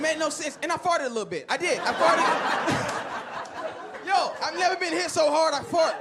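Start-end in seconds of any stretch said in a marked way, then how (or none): 2.3-4.0 high, wheezy giggle; laugh. Real, not acted. Comic admitting to the crowd he farted, helpless high laugh. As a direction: crack up at your own confession. Laughing at his own fart confession.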